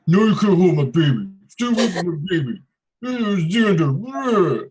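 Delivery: high, loud mocking caricature of a man; Eric voice. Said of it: "That was Eric's voice".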